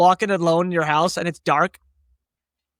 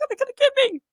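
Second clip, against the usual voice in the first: put-on scared kid; much faster than usual. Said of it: "you're like".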